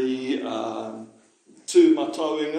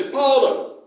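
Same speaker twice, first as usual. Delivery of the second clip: high, loud call of "Paora!"; calling out "Paora!" to get his attention; calling out a name; 10 semitones higher, 7 dB louder than usual. Retold or imitated. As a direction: shout the name across a room. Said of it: "if someone's calling out to me".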